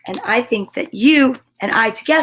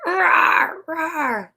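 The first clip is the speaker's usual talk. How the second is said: playful roar; 6 semitones higher than usual. Acted. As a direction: growl it like a playful little beast. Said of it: "Sometimes you are wild".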